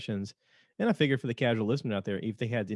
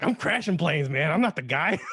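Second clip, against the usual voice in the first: Hasenfuss; 6 semitones higher, 5 dB louder than usual. Retold or imitated. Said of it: "Hasenfuss is like".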